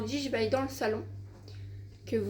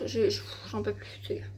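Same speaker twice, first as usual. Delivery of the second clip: whisper; 3 semitones lower, 4 dB quieter than usual. Real, not acted. Exasperated at brother.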